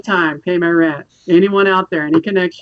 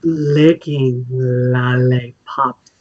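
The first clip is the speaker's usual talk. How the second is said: low and very slow seductive voice; sexy voice; 8 semitones lower, much slower than usual. Pretend. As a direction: draw it out low and sultry. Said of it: "if you say in a sexy voice".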